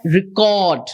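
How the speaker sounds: slow reCORD, stress on CORD; record as verb. Shown as a demonstration. Trainer showing the verb, reCORD stressed last. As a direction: lengthen the second syllable of record. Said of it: "that is a verb".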